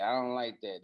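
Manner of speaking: ordinary talk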